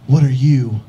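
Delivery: loud, incredulous police challenge; cop's demand. Retold or imitated. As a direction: demand it loudly, as a startled cop. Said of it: "He goes".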